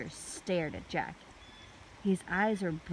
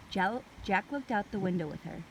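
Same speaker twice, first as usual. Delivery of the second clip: ordinary delivery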